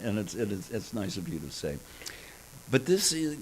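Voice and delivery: usual speaking voice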